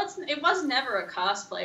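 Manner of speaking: normal talking voice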